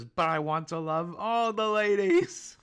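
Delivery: high, loud, slow lovestruck swoon; Sanji impression. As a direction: swoon over the ladies. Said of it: "But Sanji says".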